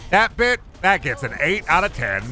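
excited. Real, not acted. Excited rating.

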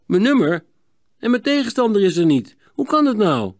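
baffled surprise. A narrator's reading. Sietse is called without an opponent, baffled surprise. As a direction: react as if it makes no sense.

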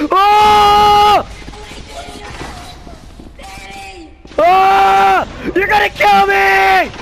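loud high panicked yell; terrified yelling. Real, not acted. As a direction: scream in terror. Being chased in game.

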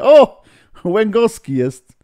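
a loud, high, delighted "O!"; surprised shout. Real, not acted. Host spots a familiar guest joining the stream, loud glad surprise.

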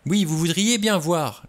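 high, loud mocking echo; agitated, exasperated retort; heated interruption. A narrator's reading. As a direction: cut in sharply, throwing the words back. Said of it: "l'interrompit Vera".

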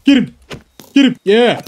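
high, loud cheering on; urgent shout. Real, not acted. Gamer urging his dog at a skeleton, loud shout. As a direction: yell at your pet to attack.

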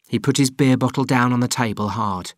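ordinary delivery

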